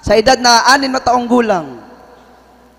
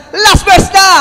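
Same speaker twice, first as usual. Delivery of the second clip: loud high call of the last verse; mock announcement shout; 6 semitones higher, 4 dB louder, slower than usual. Preacher jokingly calls out the last verse, loud high. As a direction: call it out to wake the room. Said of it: "pag sinabi mong".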